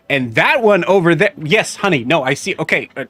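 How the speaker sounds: loud mother. Acted. Mother loudly explaining her side to the guard. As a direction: talk over everyone, distracted. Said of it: "you hear her just kinda, like, loudly".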